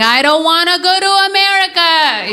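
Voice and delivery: hammed-up cry for help; mock scream. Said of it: "I would just start screaming".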